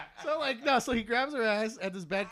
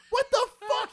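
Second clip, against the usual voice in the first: high, loud shocked outburst; disbelieving yell; 14 semitones higher, 7 dB louder than usual. Retold or imitated. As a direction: blurt it out in total disbelief. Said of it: "I'm going".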